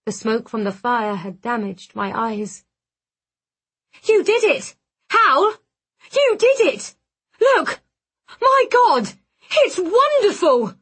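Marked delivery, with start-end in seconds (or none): 4.0-10.7 high, loud cries of joy; excited calling out. A narrator's reading. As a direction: call out in amazed triumph, pitched way up. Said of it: "Dee called".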